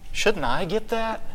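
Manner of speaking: high, soft, acted complaint; aggrieved plea. Same aggrieved churchgoer, now pleading quietly.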